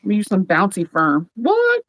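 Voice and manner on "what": high, startled "what"; a sudden jolt of surprise; surprised what. Real, not acted. Mom interrupting her own sentence, real surprise.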